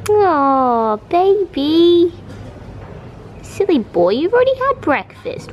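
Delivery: high cooing pet voice. Real, not acted. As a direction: melt over the bunny. Cooing at the bunny.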